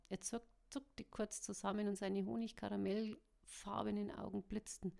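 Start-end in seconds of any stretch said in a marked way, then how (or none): none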